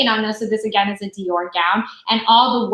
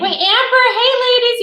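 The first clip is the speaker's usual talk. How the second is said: much higher, louder hello; excited greeting; 13 semitones higher, 5 dB louder than usual. Real, not acted. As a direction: call out cheerfully. Greeting live viewers.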